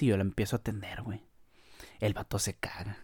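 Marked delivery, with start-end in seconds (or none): 2.0-3.0 quiet conspiratorial whisper aside. Real, not acted. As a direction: drop to a hushed whisper for the punchline. Aside about the waiter boss.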